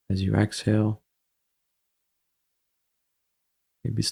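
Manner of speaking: calm, even meditation voice with long pauses; guided meditation. Acted. Guiding listeners to watch their breath, calm meditation delivery. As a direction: ease the listener into the breath, unhurried.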